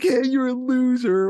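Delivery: put-on high, slow sing-song taunt of a bratty kid; mocking kid taunt. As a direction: jeer it up high like a cheeky kid.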